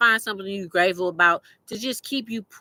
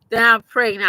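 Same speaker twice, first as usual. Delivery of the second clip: louder, punching That I'm pregnant; emphatic repeat; 3 semitones higher, 7 dB louder than usual. Real, not acted. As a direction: declare That I'm pregnant out loud after a laugh.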